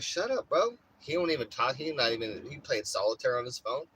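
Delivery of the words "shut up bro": high-pitched snap back; mock annoyed retort. Telling grumpy stepdad to shut up, high mock snap. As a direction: fire it back at him, pitched way up. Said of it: "I'm like".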